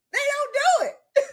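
high, slow, laughing through words. Real, not acted. Laughing at companies doing nothing.

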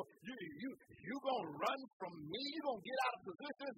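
ordinary talk, nothing marked

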